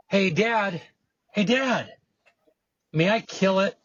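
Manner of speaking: put-on high, eager little boy calling his dad; child calling out. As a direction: call out like a small son from the next room.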